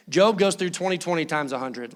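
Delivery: ordinary talk, nothing marked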